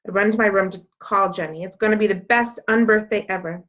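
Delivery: usual speaking voice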